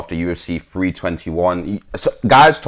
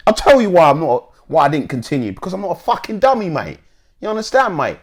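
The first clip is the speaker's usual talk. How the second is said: high-pitched, put-on Cockney; Cockney accent; 11 semitones higher, 5 dB louder, faster than usual. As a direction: quote it in an exaggerated Cockney accent. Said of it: "had to put on my Cockney accent".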